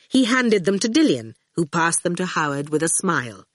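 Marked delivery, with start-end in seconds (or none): none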